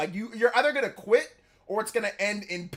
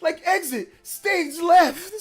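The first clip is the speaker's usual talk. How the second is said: slow, drawn-out hammed cartoon lion, pitched up; Snagglepuss; 7 semitones higher, 5 dB louder, slower than usual. Quoting the cartoon he watched with his mom, slow hammy cartoon voice. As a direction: stretch every word like a theatrical cartoon cat. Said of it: "the Snagglepuss or whatever".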